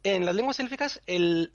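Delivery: ordinary talk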